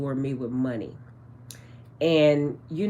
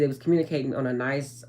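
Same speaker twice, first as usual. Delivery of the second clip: ordinary delivery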